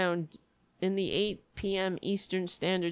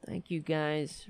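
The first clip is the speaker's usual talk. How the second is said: ordinary talk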